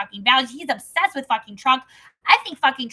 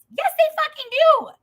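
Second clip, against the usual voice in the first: high, loud agreement; emphatic outburst; 12 semitones higher, 4 dB louder than usual. Real, not acted. Interrupting the scripture to agree, loud outburst. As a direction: break into the reading with force.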